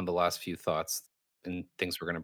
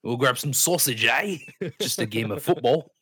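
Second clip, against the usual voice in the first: loud, higher English lad voice; hammed-up rowdy English pub lad; English lad accent; 4 semitones higher, 6 dB louder than usual. Rallying the boys for pub and football, boisterous English accent. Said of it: "you also have this English accent".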